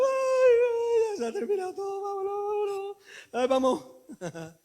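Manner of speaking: high, hammed-up crying women; mock wailing. Preacher acting the weeping women at the tomb. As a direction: wail it like grieving women. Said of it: "iban llorando".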